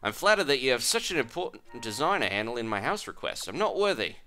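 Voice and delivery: amateur Australian character voice; bad Australian accent. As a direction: stick with a poorly faked Australian accent. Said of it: "I'm just gonna stick with it".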